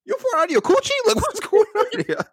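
high squeaky disbelief, laughing; shocked squeal. Retold or imitated. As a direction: shriek it in mock shock. Retold own shocked reaction.

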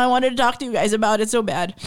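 usual speaking voice

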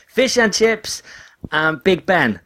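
high, bright-eyed mock girls' answer; eager girls. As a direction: chirp it out like excited young fans. Japanese girls' impression of London.

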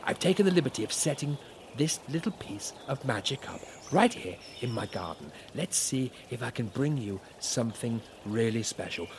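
normal talking voice

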